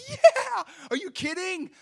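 high, loud burst of eagerness; elated, shouted exclamation; thrilled yes. Retold or imitated. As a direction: explode with an overjoyed reply. Retold eager reply to nurses.

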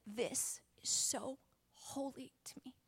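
slow, unvoiced reverent whisper; hushed voice of God. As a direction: whisper it slowly, as if holy. The Lord speaking, retold quietly.

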